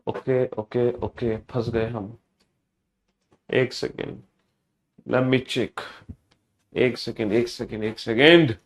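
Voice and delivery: low, subdued murmur; distracted muttering. Real, not acted. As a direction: mutter softly, caught out and buying time. Realises mistake, stalls while checking. (धीमे स्वर में, खुद से बुदबुदाते हुए)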